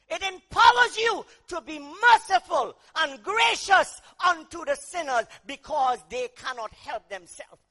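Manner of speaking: loud, driving sermon cry; fiery preaching. Acted. Preacher shouting to the congregation, loud and driving. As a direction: hammer the power of the cross home.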